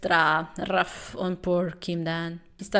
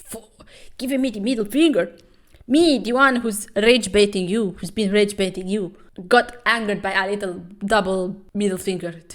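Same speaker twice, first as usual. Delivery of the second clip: louder mock outrage; indignant rant; 6 dB louder than usual. Foreign boxer fuming at the middle finger, loud mock outrage. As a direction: rant like a sore loser. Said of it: "He's like".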